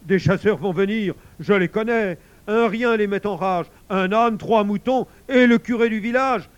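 high, loud worried bear; breathless alarm. A narrator's reading. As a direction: rattle off the danger, mounting in alarm. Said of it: "dit l'ours tout essoufflé". (ours essoufflé et affolé, voix forte)

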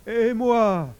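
high, loud anxious question; worried bear. A narrator's reading. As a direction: blurt it out in alarm. Bear worries about his fate. (question inquiète, aiguë et forte)

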